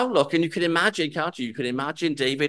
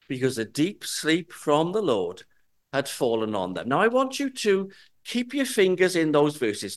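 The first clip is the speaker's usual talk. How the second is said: ordinary delivery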